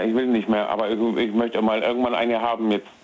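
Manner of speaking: ordinary talk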